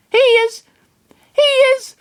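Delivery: squeaky creature stammer; high Lucario voice. Acted.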